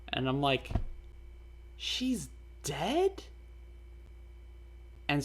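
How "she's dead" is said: high, shocked gasp of a question; can't believe she's gone, voice jumps up; stunned disbelief. Retold or imitated. Hearing online friend's goodbye, shocked disbelief. Learning his friend had died.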